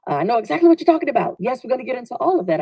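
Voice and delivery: high, fast, eager agreement; rousing yes. Retold or imitated. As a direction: fire it off like an enthusiastic yes. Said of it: "I was expecting a rousing".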